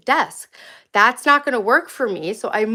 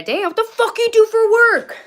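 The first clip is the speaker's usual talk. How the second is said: mocking heckler voice; 12 semitones higher, 8 dB louder than usual. Reads hostile chat comment.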